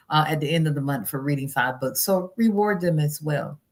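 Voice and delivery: ordinary talk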